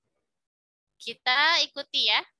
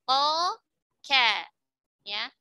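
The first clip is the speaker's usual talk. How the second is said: ordinary talk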